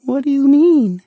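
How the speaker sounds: high, timid monster voice; a shy little creature asking, high. Acted. Rumpel asks the child, high shy creature voice.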